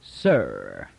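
low, drawn-out sir; insolent, dragged-out sir; slow grudging sir. A narrator's reading. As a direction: make the sir slow and reluctant. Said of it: "Devers added a slow".

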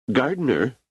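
emperor calling. Acted. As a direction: call him over with imperial ease.

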